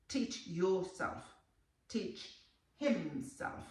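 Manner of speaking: slow, deliberate teach myself, teach yourself, teach himself; slow drill. Shown as a demonstration. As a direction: drill each phrase slowly for learners. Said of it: "enseñarme a mí mismo".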